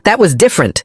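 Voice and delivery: sharp high snap back; mother snapping. A narrator's reading. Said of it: "she snapped".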